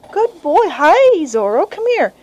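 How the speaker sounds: high, bright-toned praise for a puppy; sing-song puppy praise; praising the puppy. Shown as a demonstration. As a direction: coax the pup in with cheerful praise. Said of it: "I praise".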